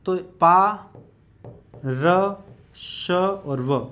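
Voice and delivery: पा, र, श और व spoken syllable by syllable, slowing right down on श और व; sounding out syllables. Shown as a demonstration. Teacher sounding out पार्श्व for students, slowly syllable by syllable. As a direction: break पार्श्व into पा, र, श and व, one by one. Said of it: "इस प्रकार से इसको बोला जाता है".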